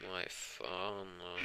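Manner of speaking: soft, drawn-out sleepy mumble; sleep talking. Acted. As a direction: murmur it quietly as if fast asleep. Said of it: "also was kinda saying asleep".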